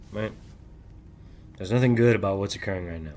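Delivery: ordinary talk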